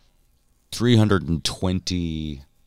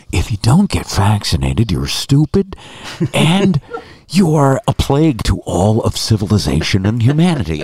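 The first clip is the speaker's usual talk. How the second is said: loud, higher-pitched emphatic rant; hammed-up scolding; mock tirade; 4 semitones higher, 7 dB louder than usual. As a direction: denounce them at full volume, comically. Imagined rant to post on Facebook.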